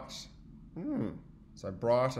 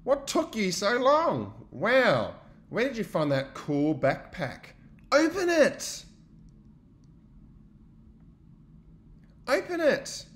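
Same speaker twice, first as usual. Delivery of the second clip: eager friends; 9 semitones higher, 6 dB louder, slower than usual. Acted. Friends clamour about backpack.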